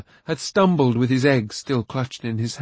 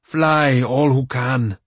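commanding; 4 dB louder, slower than usual. A narrator's reading. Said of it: "Gwydion commanded".

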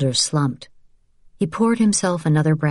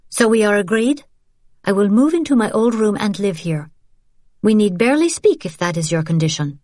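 put-on young woman voice; 6 semitones higher than usual. Isabelle voiced.

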